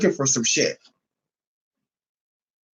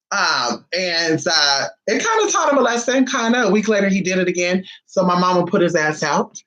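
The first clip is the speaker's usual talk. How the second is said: normal talking voice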